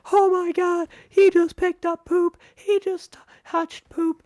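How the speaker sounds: high-pitched screaming little kids, imitated. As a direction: shriek it like a horrified child tattling. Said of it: "she hears these kids screaming".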